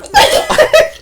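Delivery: loud high-pitched burst of laughter. Real, not acted. Cracking up at own joke.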